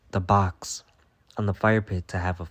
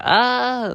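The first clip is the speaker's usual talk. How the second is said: very high, loud screech; hawk screech; 7 dB louder than usual. Retold or imitated. The hawk in the barn, a very high, loud screech. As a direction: shriek like a hawk. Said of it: "it was like".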